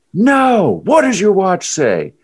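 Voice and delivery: high, loud imitation of a frantic demand; mock freak-out. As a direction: mimic a runner screaming a refusal. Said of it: "my total freak out".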